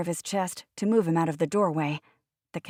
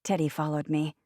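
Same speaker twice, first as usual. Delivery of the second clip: ordinary delivery, nothing marked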